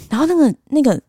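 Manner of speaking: ordinary talk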